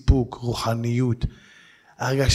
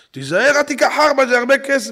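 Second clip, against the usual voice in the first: higher, louder warning wife voice; worried wife; 10 semitones higher, 5 dB louder than usual. Retold or imitated. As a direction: warn him like an anxious wife. (אישה מזהירה בקול גבוה)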